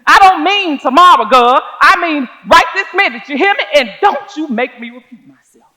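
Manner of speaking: menacing man yelling at a teacher; angry shouting. Retold or imitated. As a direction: bark the order at her. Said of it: "the man's voice got louder with every word".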